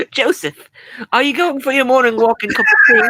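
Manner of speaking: high, loud, hammed-up British; British accent. Shown as a demonstration. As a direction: overdo a chirpy British accent. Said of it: "wants to see a British accent".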